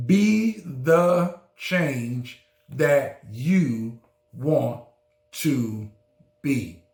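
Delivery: slowed right down, each word weighed; slow emphatic repeat. Shown as a demonstration. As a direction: let every word land slowly. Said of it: "Did you hear me?".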